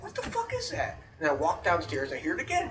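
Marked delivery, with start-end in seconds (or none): 0.0-1.0 soft, high-pitched puzzled mutter; hushed bewilderment. Retold or imitated. Looking around the house at night, hushed and baffled. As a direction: ask it under your breath, baffled.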